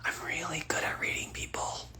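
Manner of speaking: a conspiratorial whisper; whispered secret. Real, not acted. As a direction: let it slip like a secret. Revealing secret superpower, hushed.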